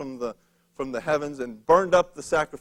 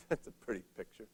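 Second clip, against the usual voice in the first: laughing aside; 6 semitones lower, 15 dB quieter, faster than usual. Real, not acted. Amused aside on soaked altar.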